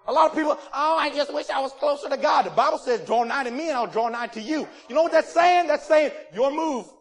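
loud, high, fiery sermon; fiery preaching. Acted. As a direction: preach it hard, building up. Preaching at full force.

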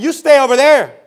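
acted townsfolk's shout; shouted warning. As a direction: call it out to someone kept at a distance. Townspeople ordering lepers away.

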